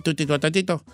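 "titototito" as a mush of t's for tiburoncito; "titototito" mumbled with the consonants lost; garbled word. Acted. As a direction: mangle it into "titototito", low and soft. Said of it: "¿Tu qué?".

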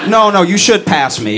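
usual speaking voice

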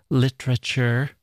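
"literature" cut to three syllables, mock posh; three-syllable pronunciation. Host mocks an intern's posh accent, three-syllable "literature". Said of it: "Three syllables".

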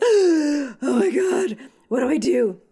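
slow, high, drawn-out oh my God; mock fangirl panic at the bar; starstruck panic. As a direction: gasp it out, starstruck.

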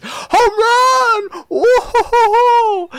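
very high, loud falsetto whoop; hammed-up squealing celebration; mock cheering. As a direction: cheer absurdly big for a tiny feat. Mocks Chloe's celebration of tiny hit.

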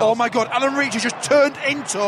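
amazed. Real, not acted. Fan marvels at his player, amazed.

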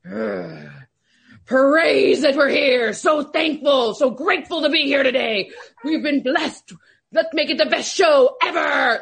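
slow, booming pump-up preacher voice, shouted out with gratitude; Michael Irvin impression. Analyst imitating her colleague's Sunday pep talk, slow and shouted. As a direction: stretch every word like a loud blessing before the show. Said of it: "you do an incredible Michael Irvin impression".

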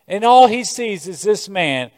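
ordinary delivery

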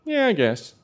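high, noncommittal preteen voice; twelve-year-old kid. Retold or imitated. Twelve-year-old replying to Mom's offer to watch, high kid voice. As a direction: answer Mom in a high child's pitch. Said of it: "And I'm twelve".

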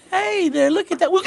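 put-on high, coaxing voice to a small child; sweet coaxing. Grandpa coaxing the toddler to look at the train, sweetly high. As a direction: coax him like a baby. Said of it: "And I say".

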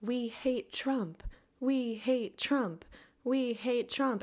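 drawn-out, high-pitched playground chant; mock group shout in a slow rhythm; imitated kids' shouting. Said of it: "shouting together".

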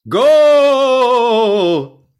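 put-on huge high goal yell, British accent; football goal shout. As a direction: bellow it like a stadium announcer, in a British accent. Football shout in mock British bit.

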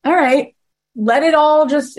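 playful resignation; mock surrender. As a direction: throw up your hands. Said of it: "So I'm like".

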